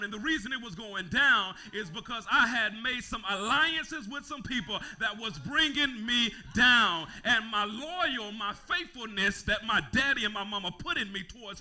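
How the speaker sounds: loud, high, stretched sermon shouting; full-force preaching. Acted. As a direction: preach it out to the whole church.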